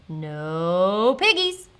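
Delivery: low, very slow cow voice; lowing, stubborn cow; mooing cow. A narrator's reading. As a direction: moo the words out long. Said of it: "mooed the cow".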